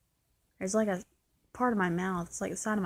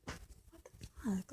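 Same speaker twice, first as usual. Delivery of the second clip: stunned whisper; 13 dB quieter than usual. Retold or imitated. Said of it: "and I'm like".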